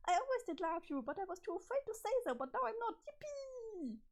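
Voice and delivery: high, bubbly and overexcited; high-pitched manic sweetness; giddy Jane voice. Acted. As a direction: gush at Jake like a lovestruck girl gone strange. Jane declares her love.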